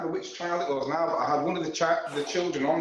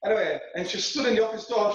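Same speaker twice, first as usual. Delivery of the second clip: ordinary talk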